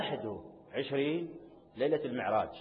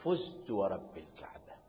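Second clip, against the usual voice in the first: hushed reverent quote; 7 semitones lower, 7 dB quieter than usual. Retold or imitated. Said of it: "أول كلمة يقول".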